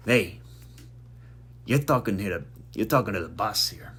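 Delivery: lightly attempted Sopranos mobster accent; half-baked Sopranos tough-guy accent; bad Sopranos accent. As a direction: play a mob boss with a shaky grasp of the Sopranos accent. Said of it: "I really can't do that accent".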